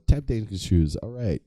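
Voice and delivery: ordinary delivery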